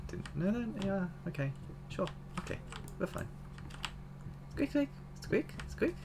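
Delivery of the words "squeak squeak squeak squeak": soft, high little squeaks; mouse squeaking. Pretend. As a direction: squeak softly like a tiny mouse. Voices the mouse on screen.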